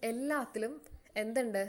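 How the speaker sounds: ordinary talk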